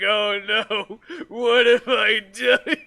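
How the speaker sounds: loud, high-pitched hammed-up dismay; mock horror. Imitating a character the morning after losing his virginity, mock horror. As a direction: wail it in mock regret, half laughing.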